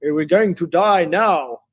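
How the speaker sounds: loud, high, drawn-out prophecy of doom; mock-dramatic alarm, swooping up loudly on each phrase; mock doomsayer. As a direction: wail it slowly like a hammed-up prophet of doom. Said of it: "people do use that kind of to say".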